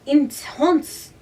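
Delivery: put-on high, loud word in a French accent. A narrator's reading. Mac adding a random word in French accent. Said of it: "say random words in a French accent".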